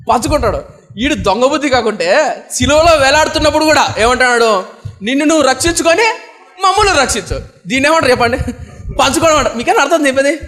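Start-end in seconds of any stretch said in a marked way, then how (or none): 5.0-7.4 high-pitched mimicked taunt; mock jeering, pitched well up; reviling. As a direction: voice the thief's self-serving demand. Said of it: "వీడు దూషిస్తూ".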